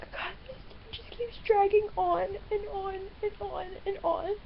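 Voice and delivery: high, worn-out, tearful complaint; tearful exasperation. Real, not acted. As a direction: let real distress lift the voice. Upset, day keeps dragging.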